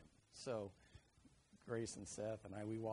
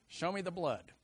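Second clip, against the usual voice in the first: gruff dad; 4 semitones higher, 8 dB louder than usual. Retold or imitated. Repeated as men's attitude.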